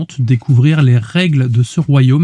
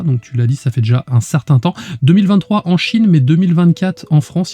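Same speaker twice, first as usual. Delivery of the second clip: ordinary delivery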